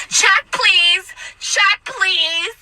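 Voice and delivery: high, pointed mock demand; indignant demand. Disgusted customer calling to server, indignant demand. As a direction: imitate a customer demanding to leave.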